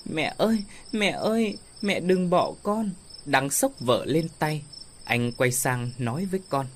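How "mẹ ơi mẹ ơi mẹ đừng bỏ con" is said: put-on crying child. A narrator's reading. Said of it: "Ngọc Mai khóc to hơn".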